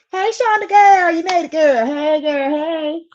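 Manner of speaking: high, loud squeal of welcome; excited greeting. Real, not acted. Host greeting Shonda as she joins the stream, excited.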